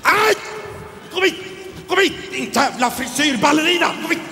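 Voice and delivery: high squealing yells of come here; high shrieking chase. Acted. Hostess chasing a guest around, squealing high.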